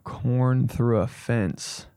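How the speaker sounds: slowly savoring each word; slow, musing. Real, not acted. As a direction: drag it out, picturing it. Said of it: "Trying to picture this shit right now".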